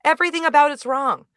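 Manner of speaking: high, loud and emphatic; excited outburst. Real, not acted. Podcast host insisting the film is a total mess, excited outburst. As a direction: burst out high-pitched, driving each verdict home. Gushing defence of a messy film.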